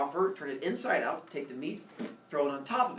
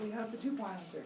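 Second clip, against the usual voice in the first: quick, softer silly echo in higher pitch; dim humans parroting; 5 semitones higher, 9 dB quieter, faster than usual. Acted. Humans echoing Zeus, dim parroting. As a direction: parrot it back quickly and softly.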